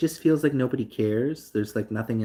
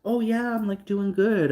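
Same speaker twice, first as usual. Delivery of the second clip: high, caught-off-guard answer; flustered reply; 10 semitones higher than usual. Retold or imitated. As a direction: stammer it out, pitched up, surprised. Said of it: "I was like".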